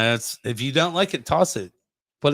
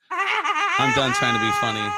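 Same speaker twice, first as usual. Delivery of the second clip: exasperated shout; slower than usual. Real, not acted. Gives up on the game.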